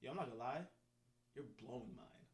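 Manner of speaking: imitating a mellow guy, low and very quiet; underplay it like the unbothered boyfriend; chill and calm. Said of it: "he's just mad chill and calm".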